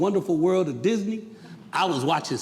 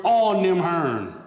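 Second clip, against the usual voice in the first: drawn-out Southern grandmama dialect, said with force; grandmama's dialect; slower than usual. Retold or imitated. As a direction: quote grandma's folksy dialect, running the words together. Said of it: "My grandmama said these words".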